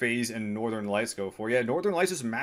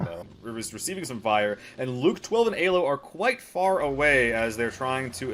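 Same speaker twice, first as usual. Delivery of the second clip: normal talking voice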